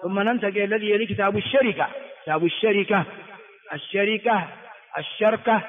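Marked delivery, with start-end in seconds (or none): 2.4-4.5 الشركة in three syllables, sha-ri-ka; full vowelled form. Shown as a demonstration. Teacher listing three dialect forms to students, full "sharika". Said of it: "الشركة ثلاث لغات". 5.0-5.6 الشركة with fatha on shin, sukun on ra; shortened middle. Shown as a demonstration. Teacher listing three dialect forms to students, shortened "sharka". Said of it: "الشركة ثلاث لغات".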